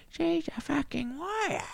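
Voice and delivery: mocking high-pitched praise. Shown as a demonstration. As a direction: sing it up high in mock praise.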